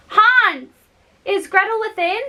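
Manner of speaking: calling out to Hans through the window; cried out. Acted. Gretel cries out to Hans at the locked door, calling loudly. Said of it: "cried out".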